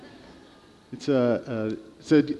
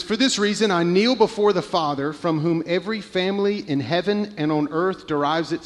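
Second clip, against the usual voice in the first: a hint of a raised, solemn reading; scripture reading; 4 semitones higher than usual. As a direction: read the verse out to the church. Reads scripture aloud.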